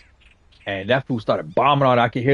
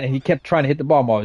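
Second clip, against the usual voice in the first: ordinary talk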